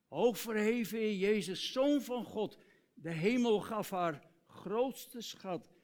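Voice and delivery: worshipful declaiming of lyrics; reciting hymn lyrics. Acted. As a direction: proclaim each line like a hymn of praise.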